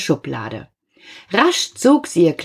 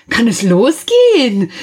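louder, higher, self-important question; haughty impatience; 5 semitones higher, 5 dB louder than usual. A narrator's reading. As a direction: ask it grandly into the air. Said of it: "fragte der Hase eine vorbeiziehende Wolke".

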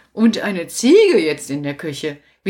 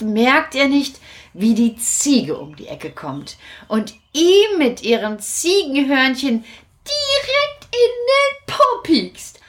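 normal talking voice

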